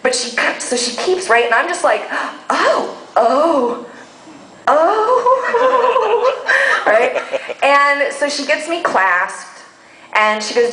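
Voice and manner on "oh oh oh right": oohs climbing high with a laugh; pleasured moans. Retold or imitated. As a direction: moan in delight. Moaning at dress fitting.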